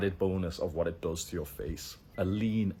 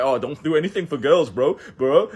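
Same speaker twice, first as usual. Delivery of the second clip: mock sigma bro; 6 semitones higher, 10 dB louder than usual. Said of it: "if I told you, like".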